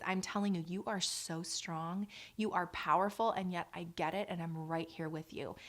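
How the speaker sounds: soft, low coaching voice that drops toward a whisper; soft steady coach counting breaths; calm labor coach. Shown as a demonstration. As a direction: breathe her through the contraction, gently and close. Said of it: "I am a coach in that moment".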